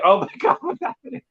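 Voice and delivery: high, panicky; scared. Retold or imitated. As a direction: panic rising at the end. Scared during silent intercom.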